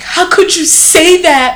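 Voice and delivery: high, slow, stunned question; aghast and drawn out; shocked sister. Retold or imitated. Sister reacting to the harsh words, slow and shocked. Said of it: "she was like".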